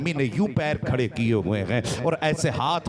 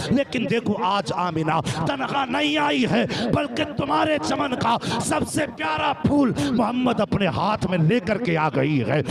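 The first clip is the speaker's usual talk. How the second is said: crying out; 8 semitones higher than usual. Acted. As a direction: call out to the grave, voice climbing. Amina calling out at Abdullah's grave.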